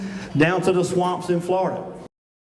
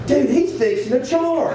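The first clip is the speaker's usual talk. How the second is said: mock alarm; 8 semitones higher than usual. Thinking buck will charge.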